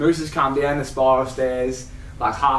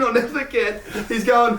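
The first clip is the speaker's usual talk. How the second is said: usual speaking voice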